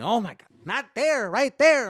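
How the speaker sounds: very high, loud frustrated Mexican dad; yelling dad. Retold or imitated. Dad yelling at his son while painting, very high and loud. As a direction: bark correction at the helper.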